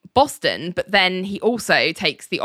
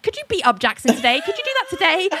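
outraged Ali; 10 semitones higher, faster than usual. Retold or imitated. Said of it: "Ali is outraged".

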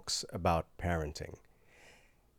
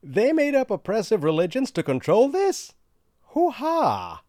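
mock amused lady; 10 semitones higher, 4 dB louder than usual.

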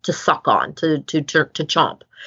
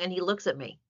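usual speaking voice

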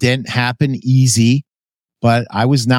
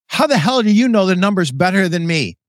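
a retold indignant snap, pitched higher; indignant retort; 6 semitones higher than usual. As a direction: play the offended boss, lightly. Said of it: "Tom really got indignant".

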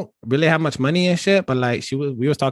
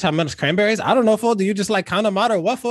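put-on laid-back Chicano street accent, every line capped with fool; 3 semitones higher, faster than usual.

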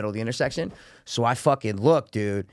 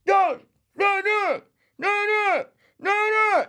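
high, far-off mock chant of no; mock the protesters' high chant; mocking protesters; much slower than usual. Imitating the protesters blocking the street, high chant.

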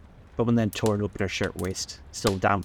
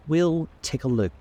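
higher and slow, hesitant; doubtful; 8 semitones higher, 3 dB louder than usual. A narrator's reading. Tom to narrator about stopping at the garage, doubtful and slow. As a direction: float the idea uncertainly. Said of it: "he said doubtfully".

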